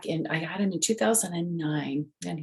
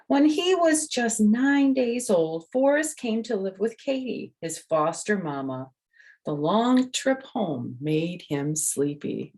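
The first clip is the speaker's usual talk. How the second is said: put-on reading to children; 5 semitones higher than usual. A narrator's reading. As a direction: read it slowly and brightly for small children. Reads the picture book aloud.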